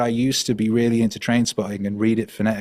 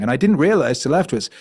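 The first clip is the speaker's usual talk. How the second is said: ordinary delivery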